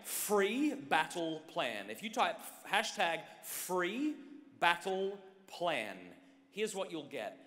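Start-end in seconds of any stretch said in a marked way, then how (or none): 0.0-1.9 slow, deliberate, each word spaced out; slow dictation. Acted. Speaker telling the crowd what to type, slow deliberate dictation. Spelling out a hashtag slowly. 2.7-6.0 slow repetition. Acted. Repeating the hashtag for typing.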